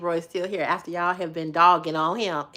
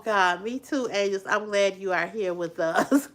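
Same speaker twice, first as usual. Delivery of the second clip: ordinary talk, nothing marked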